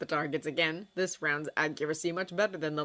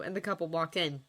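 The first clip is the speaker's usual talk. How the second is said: ordinary delivery, nothing marked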